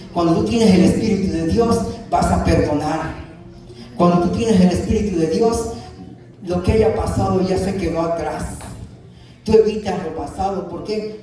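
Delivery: rising and falling pulpit cadence, pressing each point; a Spanish-language pastor preaching with conviction; fervent preaching. Acted. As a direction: preach it out to the congregation like a revival. Preaching to the congregation.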